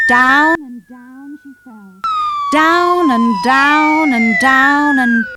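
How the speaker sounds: slow, drawn-out narration of a long fall. A narrator's reading. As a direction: stretch it out like a long drop. Dotty falling.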